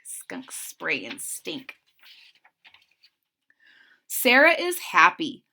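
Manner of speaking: plain reading voice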